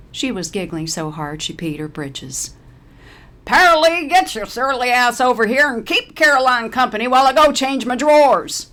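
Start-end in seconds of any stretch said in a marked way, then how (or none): 3.5-8.7 much higher, louder holler; calling out. Acted. Wilma hollers to Paralee across the dining room, loud and high. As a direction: yell across the room, tickled.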